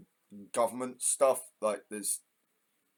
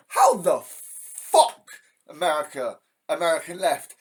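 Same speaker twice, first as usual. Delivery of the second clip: furious outburst; 2 semitones higher, 6 dB louder than usual. Real, not acted. Angry rant at the American left.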